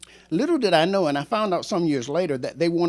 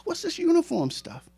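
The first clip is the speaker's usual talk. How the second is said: much higher, bright, friendly greeting; cheery hello; 11 semitones higher, 4 dB quieter than usual. Retold or imitated. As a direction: greet a long-lost student warmly. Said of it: "I Facebook him a message".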